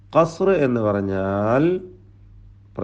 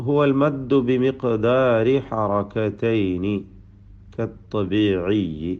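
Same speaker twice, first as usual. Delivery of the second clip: slow, measured Arabic definition read like Quran recitation; 3 semitones lower, much slower than usual. Shown as a demonstration. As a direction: draw out each Arabic word carefully, slowly. Quoting Arabic tajweed definition. (പതുക്കെ, അറബി പാരായണ ശൈലിയിൽ)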